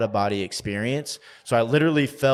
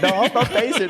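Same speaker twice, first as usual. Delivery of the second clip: high, louder mock denial; defensive denial; 11 semitones higher, 4 dB louder than usual. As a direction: deny it like a caught kid. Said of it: "I'm like".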